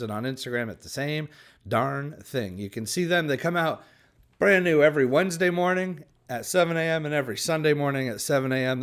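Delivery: ordinary talk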